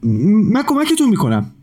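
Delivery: put-on high, eager child's voice; a kind little boy rushing to help; bright small boy offering help. A narrator's reading. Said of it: "جلو دوید و گفت".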